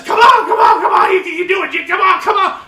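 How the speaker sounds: high, loud cheering chant; crowd yelling. Retold or imitated. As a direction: egg the pit crew on like a crowd. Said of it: "they all start yelling".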